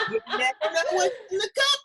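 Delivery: laughing. Real, not acted. Host teasing guest about her cup, laughing. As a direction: giggle through the words. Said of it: "laughing through line".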